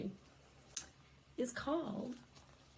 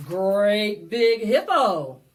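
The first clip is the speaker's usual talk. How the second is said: big hippo; 12 dB louder, slower than usual. A narrator's reading.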